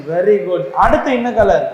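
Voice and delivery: ordinary talk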